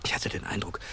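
tonlos geflüstert; flüsternd. Acted. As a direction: nach der Ermahnung nur noch flüstern. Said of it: "nicht so laut". (Gatsby flüstert nach der Ermahnung)

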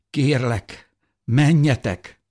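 beg, struggling with feeling; fighting emotion. A narrator's reading. Mother begs them to leave, fighting her emotions. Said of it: "érzelmeivel küzdött".

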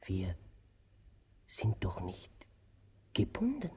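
very soft, half whispered; hushed. Acted. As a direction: let it fall to a near whisper. Hesitant uneasy aside.

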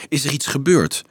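a touch of wonder, pitch lifted high; mildly amazed boy asking; surprised question. A narrator's reading. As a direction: ask it genuinely puzzled. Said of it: "vroeg Berto verwonderd".

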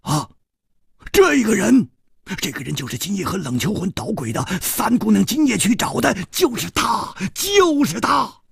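high, frantic cry of recognition; urgent high-pitched yelling; sudden shout. A narrator's reading. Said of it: "突然又有人喝道".

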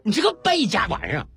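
dad scolding his son, acted without real volume; mock angry bellow. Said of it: "然后大声的吼道". (假装生气地吼孩子)

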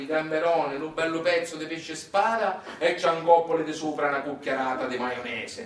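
ordinary delivery

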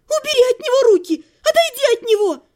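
high, loud hysterical scream; shrieking at a stranger to get away from Otto; frantic scream. A narrator's reading. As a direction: scream him away from the wounded friend. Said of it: "От собственного крика".

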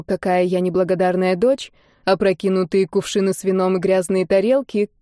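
plain reading voice

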